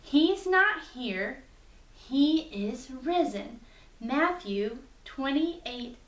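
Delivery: slow, clear, word by word; slow recitation. Shown as a demonstration. Teacher slowly models the memory verse for children. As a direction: spell it out slowly for the kids to copy. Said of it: "It goes like this".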